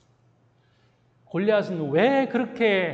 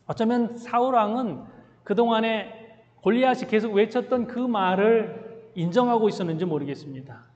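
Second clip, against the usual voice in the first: ordinary talk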